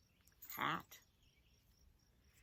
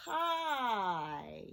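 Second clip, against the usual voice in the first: HI drawn out, the vowel gliding slowly down; slowly stretched; 3 semitones lower than usual. Shown as a demonstration. Teacher counting two sounds in hi, slowly stretched. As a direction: stretch HI so each sound can be heard. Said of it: "Let's say it slowly".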